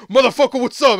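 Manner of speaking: loud, high, brash mock challenge; big rowdy imitation shout; hammed-up monster greeting. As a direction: burst in swaggering, playing the monster. Voices the monster's first entrance.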